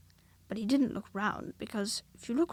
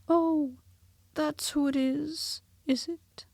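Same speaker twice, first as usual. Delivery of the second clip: higher, slow mild realisation; dawning Pooh; 5 semitones higher, slower than usual. A narrator's reading. Pooh realising who Small is, slow mild voice. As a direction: let it dawn slowly.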